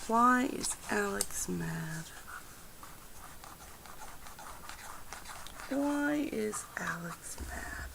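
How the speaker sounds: soft whispered question to the cards. Acted. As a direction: hush it like asking a secret.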